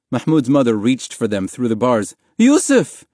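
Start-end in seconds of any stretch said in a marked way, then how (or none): 2.4-3.0 loud, very high call; crying out. A narrator's reading. Mother reaching through bars to beaten husband, crying out. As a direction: cry out desperately for your husband. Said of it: "she cried".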